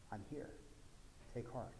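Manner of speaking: soft, near-whispered reassurance; calm them gently; Jesus softly calming his frightened friends; hushed comfort. Retold or imitated. Jesus calms disciples in fear.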